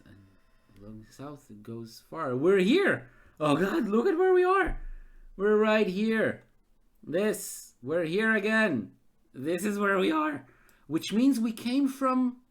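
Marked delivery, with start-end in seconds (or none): 2.4-12.4 loud, high, astonished outburst; real excitement, much higher and louder; excited disbelief. Real, not acted. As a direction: shout it out, can't believe it. Realises he's looped back.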